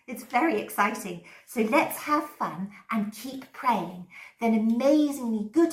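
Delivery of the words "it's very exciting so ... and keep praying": ordinary talk, nothing marked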